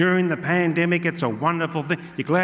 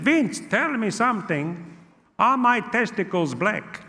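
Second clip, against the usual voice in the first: put-on high, slow Italian-accented uncle; Gino; 5 semitones higher than usual. Uncle in Corona ward asking his nephew, high Italian accent. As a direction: imitate an anxious uncle, pitched up. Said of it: "He said".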